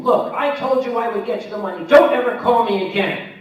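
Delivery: high, loud mock fury; mock enraged. Debtor's enraged email read as a loud angry rant. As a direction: bark it like the furious debtor. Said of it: "an enraged email came in".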